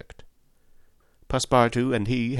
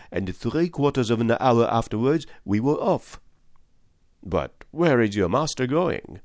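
higher, eager chattering servant voice; excitable French valet; 6 semitones higher, 3 dB louder than usual. A narrator's reading. Valet telling Fix of Paris, eager higher voice. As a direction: gush like a young valet abroad.

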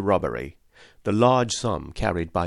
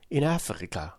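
higher, softer wondering echo of In Africa; Passepartout repeating; 5 semitones higher, 3 dB quieter than usual. A narrator's reading. Valet echoing Fix, wondering higher repeat. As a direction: echo In Africa as the wide-eyed valet, pitched up. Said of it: "repeated the Passepartout".

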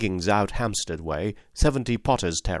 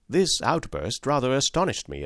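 usual speaking voice

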